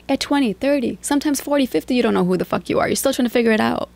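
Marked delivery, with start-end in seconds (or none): none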